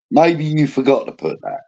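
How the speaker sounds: ordinary talk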